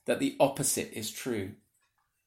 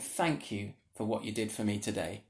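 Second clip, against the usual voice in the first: softer, lower voice of quiet thanks; tender praise; 6 semitones lower, 8 dB quieter than usual. Retold or imitated. Jesus thanking a faithful servant, softly and gently. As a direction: speak it gently, face to face. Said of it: "That voice of Jesus saying".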